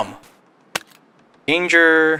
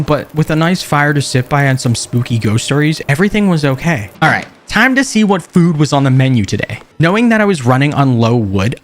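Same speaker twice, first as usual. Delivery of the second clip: calm documentary-style narration; measured survival-diary voiceover; story narration; 2 semitones lower than usual. A narrator's reading. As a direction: narrate the journey like a survival log.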